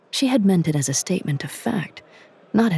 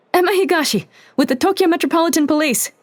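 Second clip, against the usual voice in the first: loud, high, fast shout; yelling; 13 semitones higher, 4 dB louder, faster than usual. A narrator's reading. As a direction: shout it across the chamber. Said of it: "she yelled".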